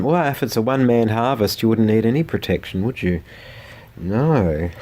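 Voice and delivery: usual speaking voice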